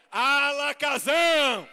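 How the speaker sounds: loud, slow magic word; stronger. Shown as a demonstration. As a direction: belt the spell out for the crowd. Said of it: "Eu quero mais forte".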